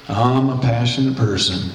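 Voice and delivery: low, listless claim of passion; mock listless. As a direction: say it with no passion at all, low and dull.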